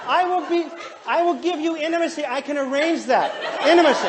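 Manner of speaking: loud, high, hammed-up eagerness; eager red husband. Husband misreading wife's wish as sex, eager hammed-up offer.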